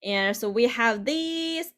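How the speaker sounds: ordinary talk, nothing marked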